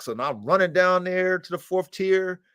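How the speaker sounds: normal talking voice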